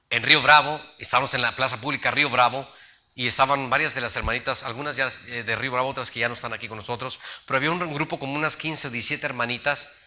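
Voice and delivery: ordinary talk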